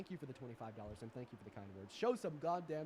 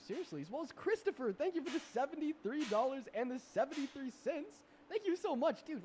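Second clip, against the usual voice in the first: hyped shout-out; 11 semitones higher, 7 dB louder than usual. Real, not acted. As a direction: yell out thanks, thrilled. Thanks another donor, thrilled.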